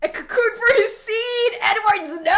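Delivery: high, loud shocked squeal; excited shriek. Real, not acted. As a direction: shriek it out, scandalized. Reacting to a shocking voice line.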